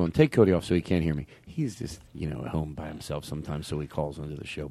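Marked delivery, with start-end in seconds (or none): 1.5-4.7 quiet aside. Real, not acted. Explains Cody out of his earshot.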